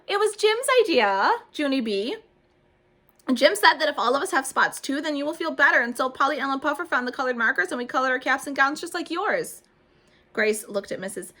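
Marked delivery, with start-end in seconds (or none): 0.1-9.6 high, loud gushing; excited girl. A narrator's reading. Grace gushing to Junie about the dots, high and loud. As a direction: tumble the story out.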